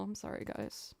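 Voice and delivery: sheepish whispered sorry; whispered apology. Real, not acted. Streamer apologizing to viewers for the ear-splitting noise, whispered. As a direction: whisper it, embarrassed.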